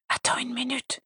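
faint, breathy whisper; barely audible, exhausted. Acted. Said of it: "presque inaudible".

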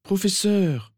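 high-pitched urgent appeal; insistent interruption. Acted. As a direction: cut in to get the professor's attention. Visitor cuts the professor off. (appel aigu et insistant)

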